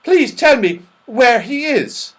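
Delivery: high, loud, slow plea; saucepan man. Acted. Saucepan man pleading to find his friend, eager. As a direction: beg eagerly in a high old voice.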